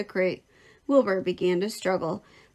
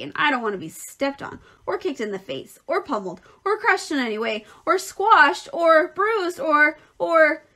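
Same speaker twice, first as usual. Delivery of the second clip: worked-up list, each item pushed harder; mounting agitation; 7 semitones higher, 5 dB louder than usual. A narrator's reading. Rat warns pig against squashing him, increasingly agitated. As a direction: build from grumbling to louder, higher alarm.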